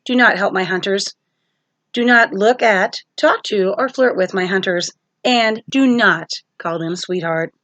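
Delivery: plain reading voice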